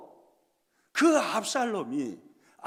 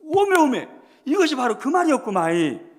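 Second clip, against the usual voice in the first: louder Jeolla dialect "오메오메", amazed; Jeolla exclamation; 6 semitones higher, 12 dB louder than usual. Retold or imitated. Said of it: "전라도 방언을 딱 대입을 시켜보면". (전라도 사투리로 감탄하듯)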